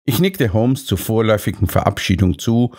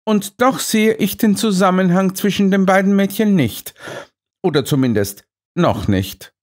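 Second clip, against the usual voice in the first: lightly voiced Holmes, a touch higher; 9 semitones higher than usual. A narrator's reading. (Holmes, leicht höher gesprochen)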